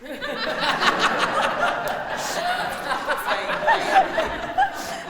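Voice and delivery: long high laugh. Real, not acted. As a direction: laugh at your own punchline. Laughs at God joke.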